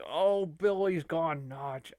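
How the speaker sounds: ordinary talk, nothing marked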